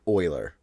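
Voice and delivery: Euler opening on "oy", like "oiler"; correct Euler pronunciation. Shown as a demonstration. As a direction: say Euler as "OY-ler". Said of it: "but it's pronounced like".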